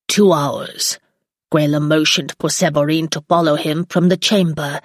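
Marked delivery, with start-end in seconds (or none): none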